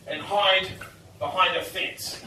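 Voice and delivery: normal talking voice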